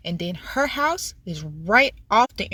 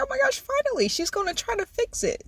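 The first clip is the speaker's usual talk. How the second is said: soft, high-pitched retold relief; inner thought; 13 semitones higher, 7 dB quieter than usual. Thinking she's finally going to fix it, hushed and high. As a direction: squeal it under the voice, hopeful. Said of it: "In my head, I'm just like".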